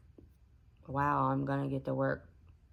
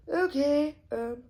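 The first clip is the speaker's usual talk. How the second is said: very high, loud "okay"; sudden playful squeal on okay; pitched way up, louder; squeaky high; 11 semitones higher, 8 dB louder, much slower than usual. Real, not acted. Playful reaction to the remaining time.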